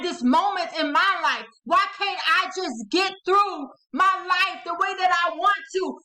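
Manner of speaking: loud, high, exasperated shouting; angry outburst. Acted. Hurt person alone, wondering why life goes wrong, angry shouted outburst. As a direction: cry out in fed-up frustration, hammering each complaint. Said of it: "I'm so angry. I'm so mad".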